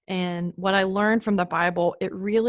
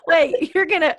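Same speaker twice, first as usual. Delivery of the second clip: much higher and louder, caught off guard; surprised; 9 semitones higher, 7 dB louder than usual. Real, not acted. Daughter startled that mom will share a childhood story, surprised and loud. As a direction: yelp it out, half alarmed.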